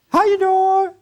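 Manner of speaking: very high, slow falsetto customer greeting; hammed-up high falsetto cashier greeting. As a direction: put on a high, sugary shop-clerk voice. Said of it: "doesn't it sound like Michelle".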